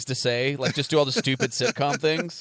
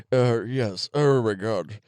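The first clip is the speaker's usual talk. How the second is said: alien chestburster voice; 2 semitones lower, much slower than usual. Pretend. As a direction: speak as a disgusted little alien. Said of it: "that's my alien chestburster voice".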